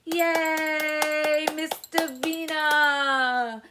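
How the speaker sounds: loud praising cheer; big happy yay. Shown as a demonstration. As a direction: applaud the baby by name. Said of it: "we're gonna say".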